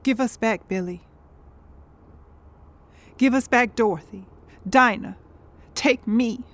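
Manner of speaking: low, weary bargaining; grieving plea. Acted. Begging to trade places with dead loved ones, grieving plea. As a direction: plead low and drained, phrase by phrase.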